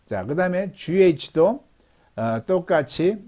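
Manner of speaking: ordinary delivery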